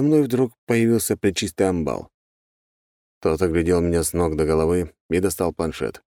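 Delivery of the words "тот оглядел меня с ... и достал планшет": usual speaking voice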